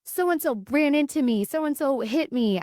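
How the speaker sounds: put-on high-pitched little kid reporting a grievance; imitated young daughter. Daughter telling her parent who bumped her, high child's voice. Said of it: "when she's like".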